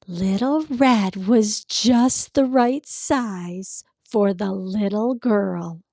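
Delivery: ordinary delivery, nothing marked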